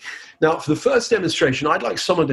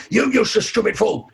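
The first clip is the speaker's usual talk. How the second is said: mock angry, a bit higher; angry insult; 7 semitones higher than usual. Shown as a demonstration. Hypnotist demonstrating an angry insult, mock angry. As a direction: snap the insult at someone. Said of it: "somebody says something really angry".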